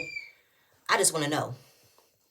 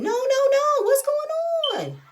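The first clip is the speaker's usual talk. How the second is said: high-pitched mock scream, drawn out at the end; squealing retold shriek, far above usual pitch; imitated screaming; 4 dB louder, slower than usual. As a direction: mimic someone screaming for it all to stop. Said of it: "You hear Candace screaming".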